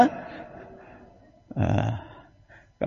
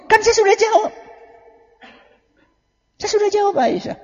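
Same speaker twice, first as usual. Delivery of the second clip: high, light voice of the Prophet; lightly voiced calm husband reassuring his wife; gentle reply. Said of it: "Apa jawaban Nabi".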